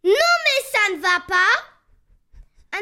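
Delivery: cri furieux, aigu et lent; hurler, réveillé en sursaut; rudely awakened. Acted. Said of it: "très énervé". (réveillé brutalement, furieux)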